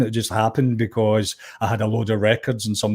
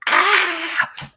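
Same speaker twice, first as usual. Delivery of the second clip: mock scream. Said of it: "all these girls turning around and just screaming".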